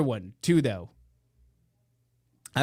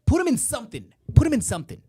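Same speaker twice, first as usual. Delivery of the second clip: high-pitched, exasperated scolding; hammed-up outraged telling-off; mock scolding; 11 semitones higher, 6 dB louder, faster than usual. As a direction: scold in a raised, much higher voice. Acted scolding at someone exposed.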